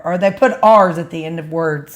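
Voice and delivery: ordinary talk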